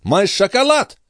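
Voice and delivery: high, insistent lady's demand; demanding countess. A narrator's reading. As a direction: repeat the order sharply, pitched high. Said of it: "повторила графиня".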